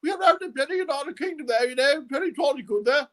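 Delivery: very high, warbling British royal voice; Queen of England. Shown as a demonstration. Impressionist contrasting UK accents, as the high-voiced Queen. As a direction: pitch it way up, regal English. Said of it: "Queen of England's a little bit different".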